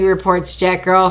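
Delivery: plain reading voice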